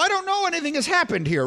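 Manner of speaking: ordinary delivery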